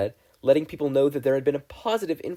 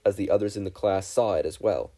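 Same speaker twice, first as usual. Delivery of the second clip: usual speaking voice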